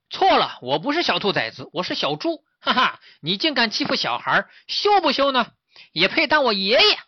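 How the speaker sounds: high-pitched, lively mockery; cocky taunting piglet. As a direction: jeer boldly at a beaten bully. 小猪在树上嘲笑野猪. (小猪得意地高声嘲笑)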